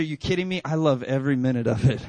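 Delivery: playing up a father's relish; retold gusto. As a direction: build into a loud, relishing declaration. Retold gleeful comeback to son.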